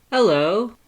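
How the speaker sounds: high, loud, bright greeting of a giant skeleton; cheerful Papyrus. Acted. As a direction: chirp hello way up high and loud. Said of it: "he said cheerfully".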